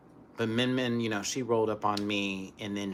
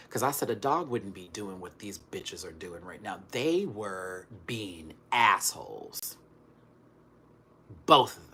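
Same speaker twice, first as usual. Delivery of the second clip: normal talking voice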